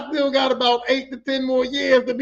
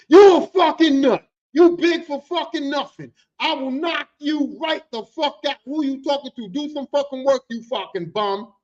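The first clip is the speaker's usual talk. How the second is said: angry retelling; 4 semitones higher, 5 dB louder than usual. Retold or imitated. Big for Something cusses out his coworker, angrily. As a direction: hammer out each insult, mock-enraged.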